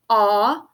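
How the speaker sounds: held "ah"; phonics-teacher "ah", standing alone; single sound. Shown as a demonstration. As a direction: say "ah" as one long open vowel. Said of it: "one sound".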